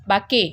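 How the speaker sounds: बाके said with a long open a, baa-kay, the wrong way; बाके as a two-syllable baa-kay mistake; wrong reading baake. Shown as a demonstration. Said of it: "ऐसे नहीं पढ़ेंगे".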